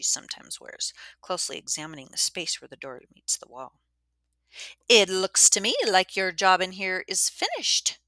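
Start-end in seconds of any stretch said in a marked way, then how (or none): 4.9-8.0 cold, higher and louder dismissal. Acted. Missus Dwyer dismissing Davis, cold and loud. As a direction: chill him out of the room. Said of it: "she said coldly".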